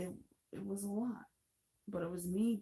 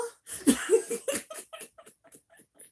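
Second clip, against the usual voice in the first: a burst of high, loud laughter; 11 dB louder than usual. Real, not acted. Retelling the aunt's shock to a friend, laughing loudly. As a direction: crack up loudly at the memory.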